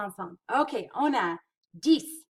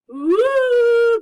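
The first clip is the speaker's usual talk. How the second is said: delighted whoop; 14 semitones higher, 16 dB louder than usual. Acted. Delighted praise for correct answer.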